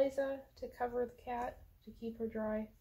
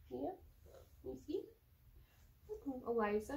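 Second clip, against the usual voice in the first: ordinary delivery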